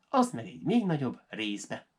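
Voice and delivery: usual speaking voice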